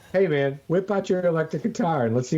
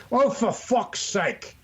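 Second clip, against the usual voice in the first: high, slow, exasperated; mock-exasperated catchphrase; 9 semitones higher, much slower than usual. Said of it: "It's my new catchphrase".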